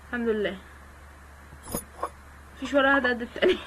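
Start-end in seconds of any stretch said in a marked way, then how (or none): 2.6-3.6 fast, laughing through the words. Real, not acted. Gamer laughing at her uneven sushi slices, fast laughter. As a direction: laugh helplessly at the mess.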